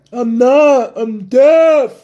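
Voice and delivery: loud, high, drawn-out imitation of deaf speech; imitated deaf speech. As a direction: act deaf, pushing the words out high and loud. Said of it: "I had to act like I was deaf".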